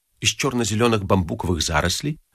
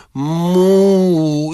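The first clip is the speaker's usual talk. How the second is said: put-on drawn-out toddler call, higher pitched; шаловливый карапуз; 6 semitones higher, 4 dB louder than usual. A narrator's reading. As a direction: stretch it out like a naughty little kid. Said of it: "голосом шаловливого карапуза протянул". (шаловливо протянуть голосом карапуза)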